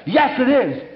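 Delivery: high, insistent comeback; angry retort. Retold or imitated. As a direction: imitate a furious man insisting. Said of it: "He got that angry".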